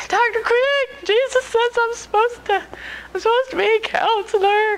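high-pitched, hammed-up wail; mock whining. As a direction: whine the bad news to your mentor. Tearfully tells mentor news, acted.

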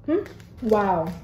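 chewing while talking; talking around a gummy; mouth partly full. Real, not acted. Seen 0: Gummy put in mouth at -2; chewing look early, then normal talk.

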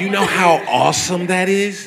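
high, loud burst of hype; loud excitement. Real, not acted. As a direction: exclaim it in disbelief at how great it is. Hyping bat watching to the host.

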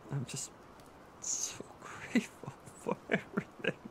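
breathy, voice breaking into near-whisper; choked up. Acted. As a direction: overcome with tearful gratitude, words barely voiced. Tearful thanks to comforting partner.

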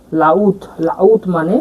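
লাউত said slowly, each sound clear, "la-ut"; slow model word. Shown as a demonstration. Teacher reading the Malay word for students, slow and clear. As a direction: draw out লাউত so students catch it. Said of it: "এল এর উচ্চারণ এ আর ল".

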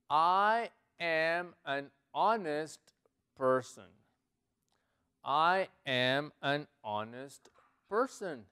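very slow, each word set apart; slow model reading. Shown as a demonstration. Teacher modelling an English sentence for learners, very slowly. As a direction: stretch and separate every word.

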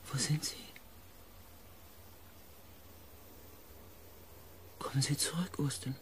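soft, careful appeal; hushed pleading. Acted. Narrator begs Austin to come back, hushed plea. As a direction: coax gently, keeping the voice down.

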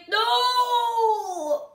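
long, high drawn-out cry; dismayed wail. Real, not acted. Gamer watching the match get lost, dismayed wail. Last teammate dies, game lost.

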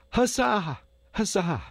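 very high-pitched victory cry; exultant hurrah; triumphant cheer. A narrator's reading. Crowd cheers the winner.

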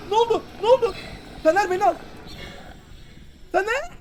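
panicked high-pitched yelling; being chased. Real, not acted. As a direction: scream for your friend in panic. Monster catches him in game.

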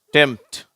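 டெம்ப்ட் with the p silent, said as டெம்ட்; teacher showing the silent p in டெம்ப்ட்; model word tempt. Shown as a demonstration. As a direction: say டெம்ப்ட் without the p sound. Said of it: "எப்படி உச்சரிக்கிறோம்".

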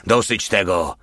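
usual speaking voice

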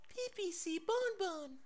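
high, slow little creature voice; squeaky pet voice. Acted. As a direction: talk like a tiny pet. Pet creature speaks in baby talk.

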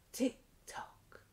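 whispered "tick tock", soft and breathy. Acted. Teacher whispering "tick tock" to toddlers in the song.

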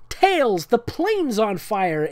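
high, panicked shriek, slow end. Acted. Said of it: "Amy shrieked".

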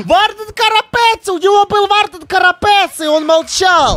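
high, loud excited exclaim; excited outburst. Real, not acted. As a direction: burst out with delight. Excited outburst about donation. (восторженный возглас)

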